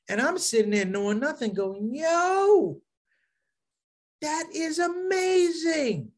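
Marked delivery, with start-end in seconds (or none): 1.9-6.1 high, slow, amazed; drawn-out wonder at the crowd; high, slow awestruck; awed. Retold or imitated. Awed newcomer at his shahada.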